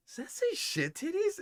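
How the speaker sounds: very high, incredulous question; mock bewilderment. Imagined finder puzzling over kid's handwriting, mock baffled high voice. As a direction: squeak it like a baffled reader.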